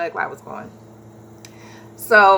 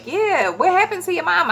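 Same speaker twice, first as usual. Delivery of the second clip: much higher questioning voice; pitched-up curious kid imitation; imitating son's friends; 10 semitones higher than usual.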